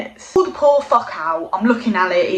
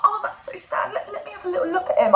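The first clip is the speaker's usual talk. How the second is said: sympathetic foster mum; 10 semitones higher, 6 dB quieter, faster than usual. Retold or imitated. Foster mum pitying Kit over the dead hamster, high soft cooing. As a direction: coo like a mum over something sad. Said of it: "She's like".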